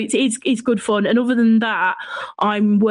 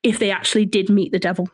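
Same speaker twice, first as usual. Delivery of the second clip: ordinary talk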